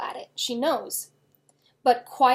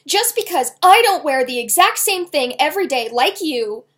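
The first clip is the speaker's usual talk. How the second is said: loud, higher shout; 4 semitones higher, 10 dB louder than usual. A narrator's reading. Girl shouting back at her dad, furious. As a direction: shout to match him. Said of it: "Ella found herself starting to shout".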